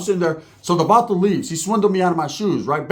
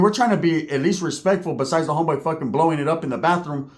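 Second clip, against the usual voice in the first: normal talking voice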